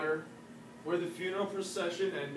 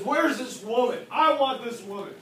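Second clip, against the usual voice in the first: loud, higher, angry emperor; angry demand; 4 semitones higher, 6 dB louder than usual. Acted. Emperor arriving at the wall with his troops, angry. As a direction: demand her with raised voice. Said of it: "he's getting so mad, so angry".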